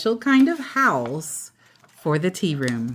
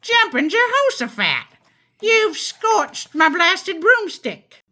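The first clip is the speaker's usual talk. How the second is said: angry witch; 11 semitones higher than usual. Acted. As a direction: sputter like a furious old witch. Witch angry about scorched broom.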